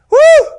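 sudden piercing "woo" of amazement; short awestruck whoop; loud high whoop. Acted. Acting Isaiah's amazement.

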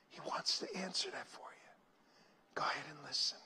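very soft whispered prayer. Acted. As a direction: hush it to a whisper. Whispered altar call.

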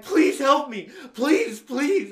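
high, loud begging; acted-out panicked begging; imitated desperate plea. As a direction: plead frantically, acting it out. Retells dying man begging friends.

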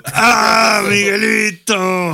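high, drawn-out shouted cry; hammed-up crying yell, stretched out; loud mock wail. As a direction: wail the name at full volume. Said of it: "disculpa a los que usan audífonos".

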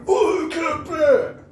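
high, slow, shouted like the game announcer; announcer yell. Retold or imitated. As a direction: bellow the title like the arcade announcer. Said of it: "you can't help but go".

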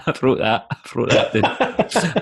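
laughing through the words, slow and high. Real, not acted. Friend laughs reading out his own silly note. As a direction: giggle it out, amused at himself.

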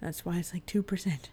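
whispered aside; whispering. Real, not acted. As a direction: drop to a whisper. Aside while other talks.

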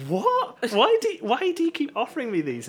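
high-pitched incredulous protest; laughing, baffled retort; imitated bafflement. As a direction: squeak it in mock disbelief. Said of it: "And Harry was like".

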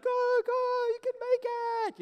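high-pitched, loud urging; frantic cheering. Acted. Viewer watching the gazelle flee, frantic high-pitched cheering. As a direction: squeal encouragement like a desperate spectator.

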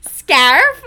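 loud, exaggerated American "Scarf?" with a flattened vowel; hammed-up American accent, breaking into laughter; overdone American accent. Shown as a demonstration. Recalling a failed American accent attempt, overdone American "Scarf?". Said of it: "tried to do an American accent for scarf".

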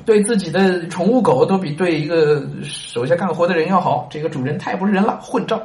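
the fool's indignant outburst. Acted. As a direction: blurt it out in fast outrage. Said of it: "傻子就说".

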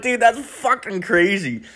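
high, slow stunned "dude"; a high, drawn-out awed reaction; amazed disbelief. Retold or imitated. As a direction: let it hang, stunned and amused. Reacting to friend's story.

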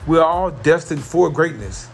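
ordinary talk

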